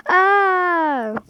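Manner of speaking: sudden high yelp of fright; a short yelp, caught off guard; startled high cry. Real, not acted. Threatened by a voice.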